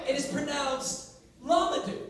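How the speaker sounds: Lamadou said clearly and carefully; correct pronunciation. Shown as a demonstration. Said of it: "Let's set the record straight".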